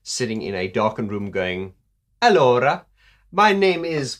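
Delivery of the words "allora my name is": higher, louder mock Italian woman; hammed-up Italian lady introducing herself; Italian woman's accent. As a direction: put on a playful Italian accent. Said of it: "a beautiful young Italian woman".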